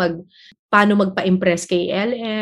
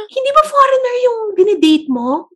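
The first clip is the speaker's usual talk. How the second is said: put-on much higher, laughing childish voice; a kid puzzled, giggling through it; child's question; 11 semitones higher than usual. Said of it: "sabi niya". (batang nagtatanong habang tumatawa, mataas ang boses)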